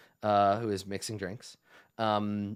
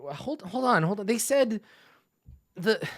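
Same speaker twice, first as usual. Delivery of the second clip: higher-pitched, stumbling worry; flustered protest; 8 semitones higher than usual. Acted. Waitress objecting to the goblin's paperwork threat, flustered. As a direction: halt them, stammering in mild alarm.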